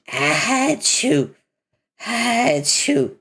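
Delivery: high, sharp fake sneeze. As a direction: achoo from allergies, sneezing. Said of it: "My allergies".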